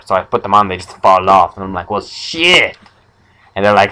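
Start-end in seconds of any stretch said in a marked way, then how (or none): 1.9-2.8 high-pitched exasperated reaction; mock dismay. Noseless man losing his sunglasses, mock dismay. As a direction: let the pitch leap in comic dismay.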